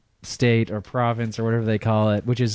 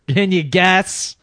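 ordinary talk

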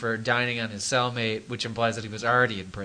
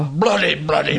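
loud, hammed-up English accent; mock English accent; 2 semitones higher, 6 dB louder, slower than usual. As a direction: throw in a louder, jokey English accent. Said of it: "with an English accent".

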